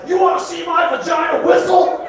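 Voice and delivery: high, rowdy mock shout; drunken woman. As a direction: whoop it out like a wild party girl. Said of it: "they'd be like".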